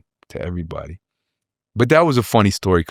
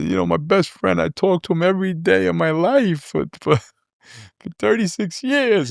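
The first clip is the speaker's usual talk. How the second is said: crying; 11 semitones higher than usual. Retold or imitated. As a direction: choke it out through tears. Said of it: "I'm crying".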